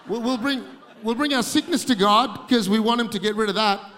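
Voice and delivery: loud driving sermon line; rising preacher's roar; preaching. Acted. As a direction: punch it to the crowd. Preaching laughing crowd.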